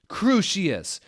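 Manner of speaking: loud, high battle cry; shouted spell. A narrator's reading. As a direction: fling the curse with full force.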